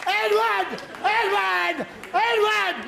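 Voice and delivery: high, repeated yell of a name; insistent shouting. Acted. Dad stands over his son's bed, shouting his name. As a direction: shout to get through earphones.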